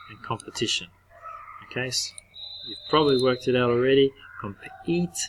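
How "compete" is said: whispered Compete with a higher rise; whispered demo. Shown as a demonstration. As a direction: breathe out Compete softly. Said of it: "we can hear that long vowel".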